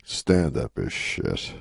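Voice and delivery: imitating a veteran comic, low and very slow; retold blunt punchline. Veteran comic undercuts his speech after pausing, slow mock-solemn verdict. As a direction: drop the voice and draw out the verdict. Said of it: "he pauses, and then he goes".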